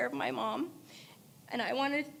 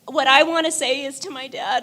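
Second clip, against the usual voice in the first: normal talking voice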